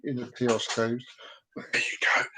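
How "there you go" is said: a soft whispered aside; hushed unvoiced whisper. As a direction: breathe it out secretly.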